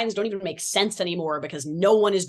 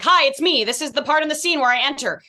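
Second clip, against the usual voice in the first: bright, higher, louder entrance voice; imitating Philly; 6 semitones higher, 4 dB louder than usual. Actor imitating castmate Philly entering the scene, higher and louder. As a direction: announce your entrance like a sing-song castmate. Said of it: "And then Philly will walk in and be like".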